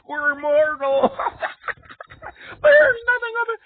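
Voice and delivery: high, loud crying wail; hammed-up crying shout. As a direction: wail it like a weeping god. Heavenly voices wailing.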